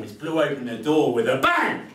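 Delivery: loud, punched narration of the bang; dramatic bang. A narrator's reading. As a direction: slam the words like the door.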